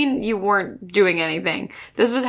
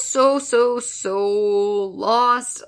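each "so" stretched long before "lost"; drawn-out emphasis; much slower than usual. Real, not acted. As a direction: draw out every "so" for emphasis. Stressing how confused she was.

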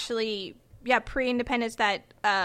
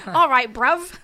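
mock British greeting; 7 dB louder, slower than usual. Imitating British slang greeting.

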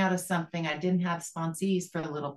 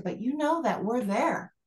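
ordinary delivery

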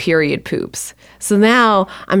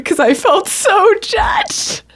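slow wail climbing high; hammed-up sobbing, words stretched out; mock crying; 14 semitones higher, much slower than usual. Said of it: "to cry so hard".

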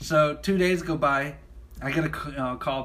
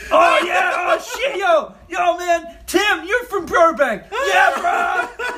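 very high, loud mock excitement; hyped-up bros; 12 semitones higher, 7 dB louder, slower than usual. Office bros reacting to a hometown, absurdly over-excited. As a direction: whoop it up over nothing.

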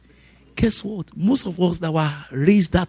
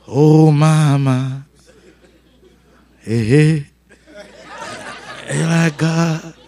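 drawn-out mock lament; 3 semitones lower, much slower than usual. Remembering mama, drawn-out exclamations.

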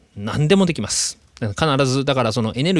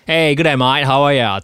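casual Australian greeting, a bit louder; a relaxed Aussie "good day, mate"; Australian accent; 4 dB louder, slower than usual. Retold or imitated. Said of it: "とか言うんですけど". (軽いオーストラリア訛り)

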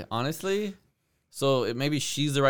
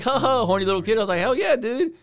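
mock hyped teen; 9 semitones higher than usual.

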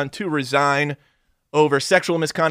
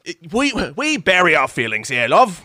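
lightly put-on English voice; mock English accent; 2 semitones higher than usual. Royals explaining themselves, light English accent. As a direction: stiff upper lip, lightly voiced.

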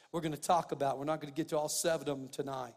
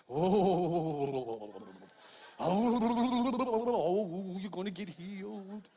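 hammed-up, wavering "ohhh" into a chanted healing; Jesus; much slower than usual. Preacher mocking showy healing prayers, exaggerated holy voice. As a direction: mock-holy voice with long, drawn-out vowels. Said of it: "let me get my Jesus voice".

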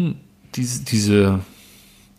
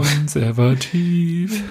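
langsam, gedehnt und höher; spöttisch gedehnt; 5 semitones higher, much slower than usual. Pretend. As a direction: zieh das Wort spöttisch lang. Spöttischer Kommentar zu Bayern. (spöttisch langgezogen, etwas höher)

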